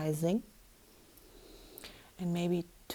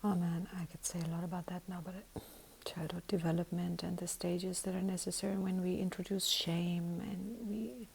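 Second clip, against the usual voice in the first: hushed whisper; 7 dB quieter than usual. Real, not acted. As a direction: keep it to a whisper. Soft whispered musing.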